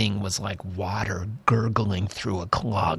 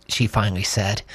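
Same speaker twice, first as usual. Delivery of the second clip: usual speaking voice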